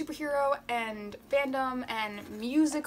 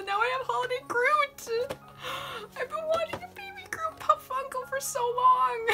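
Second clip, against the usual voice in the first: high, teary cry of joy; almost crying with happiness, voice pitched high; happy tears; 12 semitones higher than usual. Real, not acted. Finally holding the baby Groot, she cries out in tearful joy. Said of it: "I'm gonna cry".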